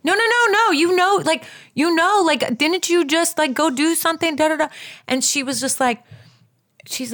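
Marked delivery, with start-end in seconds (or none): 0.0-4.7 high-pitched urgent prodding; insistent prompting. Retold or imitated. Friend presses her to remember the doctor, insistent. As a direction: imitate a friend jogging someone's memory, pitched well up.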